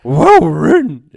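loud, slow drawn-out impression; Wil Wheaton voice. Shown as a demonstration. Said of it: "I was trying to say Will Wheaton".

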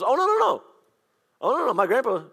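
high, loud mock protest of no; lightly acted startled denial; emphatic denial. Retelling the friend's denial, high and loud.

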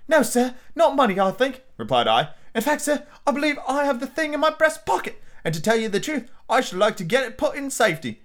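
put-on high, polite boy; young boy voice. A narrator's reading. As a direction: speak up like a polite lad. Young Jim answers the supervisor.

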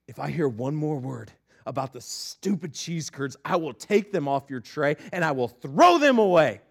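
acted simmering ultimatum; stern dad threat. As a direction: warn the kids, then let it rise. Dad threatening sons over curds.

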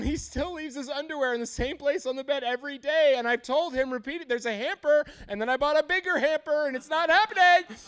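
high, loud mock complaint; exasperated wife. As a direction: rant like a fed-up wife, building to a peak. Voices wife complaining about husband's underwear.

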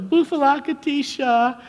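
high, loud mimicking shout; imitated yell back. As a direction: holler the child's chant back in a squeal. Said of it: "and we'd yell back".

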